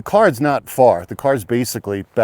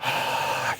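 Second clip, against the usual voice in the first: long airy exhale; deep breath out; 10 dB quieter than usual. Shown as a demonstration. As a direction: let out one big deep breath. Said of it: "breathe deep".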